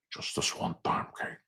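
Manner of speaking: low-key whispery mobster; growing-quiet Godfather husband voice; Pacino impression. Godfather husband asking Kate for one time, hushed Pacino impression. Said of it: "just go full Pacino".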